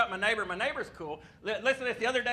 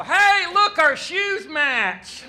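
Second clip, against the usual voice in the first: loud, much higher and very slow, overexcited; eager pickup line; 11 semitones higher, 11 dB louder, much slower than usual. Retold or imitated. Man hitting on a girl at the bowling alley, loud slow eager pitch. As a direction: stretch it out like it's a brilliant discovery.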